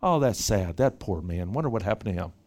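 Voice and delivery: low, soft mock sigh of pity; a lightly voiced shallow onlooker, quiet and low. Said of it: "He feels for this guy in a way that goes beyond".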